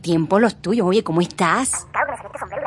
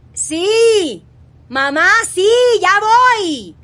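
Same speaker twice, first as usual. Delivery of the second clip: loud, high shout to someone far away; calling out; 11 semitones higher, 4 dB louder, much slower than usual. Acted. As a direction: yell it across the house. Yelling back to mother in another room.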